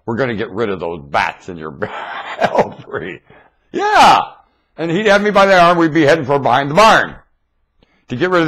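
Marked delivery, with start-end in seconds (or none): 0.1-3.2 low, soft threat broken by laughter; dad, laughing. Retold or imitated. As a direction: laugh through a father's mock threat. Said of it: "And he'd say".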